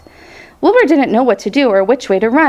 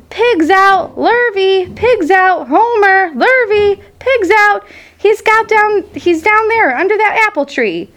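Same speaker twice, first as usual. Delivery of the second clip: crying the alarm; 9 semitones higher, slower than usual. A narrator's reading. Farm wife alerts the men to an escaped pig, calling high. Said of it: "she cried".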